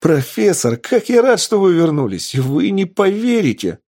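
high, loud burst of excitement; радостное возбуждение. Acted. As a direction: gush with relief at the professor's return. Лаборант радуется возвращению профессора. (радостно, взахлёб)

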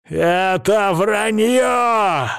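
high, drawn-out outraged cry; cracking-voice yell. A narrator's reading. Krestovsky denies the revelation, yelling in a breaking voice. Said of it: "срывающимся голосом орёт Крестовский".

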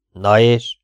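loud, miffed retort; slightly offended. A narrator's reading. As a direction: toss it back, a little hurt. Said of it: "mondta kissé sértődötten". (kissé sértődött, hangos visszavágás)

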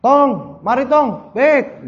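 put-on calling the boy from afar, high; half-shouted Betawi call and reply; mock yelled call. Betawi parent calling a child, mock yell. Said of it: "baiknya sambil teriak kadang-kadang".

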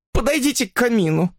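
high-pitched woman's instruction; reluctant woman giving in; distressed sick woman. A narrator's reading. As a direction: direct him in a high, plaintive voice. Woman reveals hiding place.

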